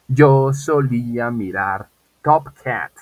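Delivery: each word drawn out slowly and loudly; slow model sentence. Shown as a demonstration. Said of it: "Quiero decir".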